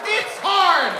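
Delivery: high, loud protest; mock outraged shout. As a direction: yell it in mock exasperation. Protests at being booed.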